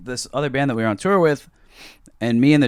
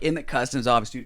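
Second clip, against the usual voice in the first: ordinary talk, nothing marked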